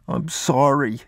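put-on high, small boy's apology; little boy near tears, pitched high; about to cry. A narrator's reading. Boy who left the pistol behind, near-tears apology. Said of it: "he was about to cry".

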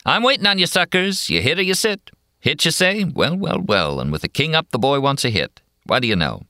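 high, brash dealer patter; brash card dealer. A narrator's reading. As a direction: sell every card like an auctioneer. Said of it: "talks around it like a stock auctioneer".

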